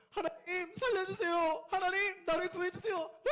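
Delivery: high, loud, wailing plea; crying prayer. Acted. David crying out to God, high wailing plea. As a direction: cry out to God for rescue. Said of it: "울면서 기도했어요".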